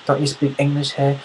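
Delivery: mock an exasperated Brit firing off questions; put-on British accent, worked up; British, flipping out. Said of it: "British guy flips that".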